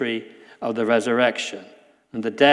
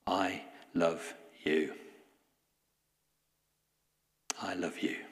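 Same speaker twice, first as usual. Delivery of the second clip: slow, hushed and tender, softer; a quiet slow loving whisper of a promise; 5 semitones lower, 15 dB quieter than usual. Retold or imitated. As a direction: let each I love you land slowly and gently. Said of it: "Jesus says today".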